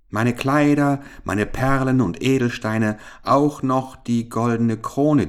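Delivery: lightly voiced princess, pressing her offer; eager princess. A narrator's reading. Princess offers the frog all her treasures, eagerly.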